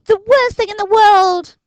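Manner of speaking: put-on high, loud despairing boy's voice; groaning Dinking. A narrator's reading. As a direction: wail it like the world is ending. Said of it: "groaned Dinking".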